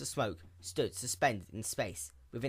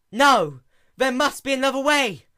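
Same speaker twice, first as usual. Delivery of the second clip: loud, high desperate protest; yelling; 12 semitones higher, 14 dB louder than usual. Acted. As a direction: cry out against it, desperate. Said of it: "Luna yelled".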